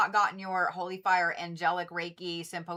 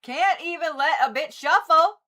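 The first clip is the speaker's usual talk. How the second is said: higher and louder, fed up with the cards; exasperated complaint; 8 semitones higher, 8 dB louder than usual. Real, not acted. To the tarot deck, louder and exasperated. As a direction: scold the deck for not letting her shuffle.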